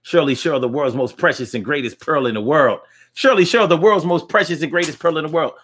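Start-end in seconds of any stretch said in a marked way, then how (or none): 3.1-5.5 high announcer voice. Shown as a demonstration. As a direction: sing out the name high and bright like a host. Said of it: "Are you turned on by voices?".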